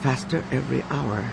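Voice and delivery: soft remembered words; hushed echo. A narrator's reading. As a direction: murmur it like an echo in the mind. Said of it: "reverberating in their thoughts".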